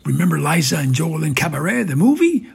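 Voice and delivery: loud, fast, rising excitement; rapid, eager burst; rousing himself. Acted. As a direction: perk up loudly, pitch climbing to the end. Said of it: "rousing himself again".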